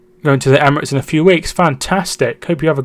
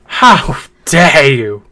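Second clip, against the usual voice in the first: slow, drawn-out mock outrage; mock offence; 3 dB louder, much slower than usual. Streamer answering a jab at Welsh, mock offended. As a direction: stretch the words out in pretend indignation.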